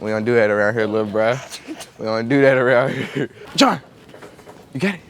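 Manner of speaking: ordinary delivery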